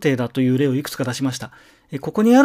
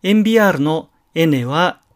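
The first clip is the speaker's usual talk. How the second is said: normal talking voice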